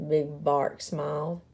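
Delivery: ordinary delivery, nothing marked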